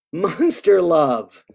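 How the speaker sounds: slow, drawn-out refrain. Acted. Reader closes each verse for children, slow drawn-out refrain. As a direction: stretch each word of the refrain.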